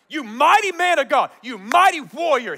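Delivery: loud, high proclamation; booming hail. Retold or imitated. Messenger hailing Gideon as a mighty warrior, booming. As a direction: hail a hero at full voice.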